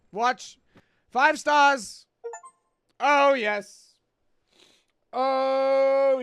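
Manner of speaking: loud, high victory cry; triumphant cheer. Real, not acted. Streamer to chat, loudly cheering five stars. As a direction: crow over the five-star dish. Celebrating a five-star dish.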